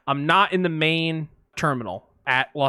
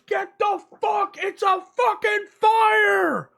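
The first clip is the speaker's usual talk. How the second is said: high, slow, furious shout; screaming fire marshal; slower than usual. Retold or imitated. Fire marshal with no bullhorn screaming at everyone to leave. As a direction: bellow it at the whole hall. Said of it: "screaming at the top of his lungs".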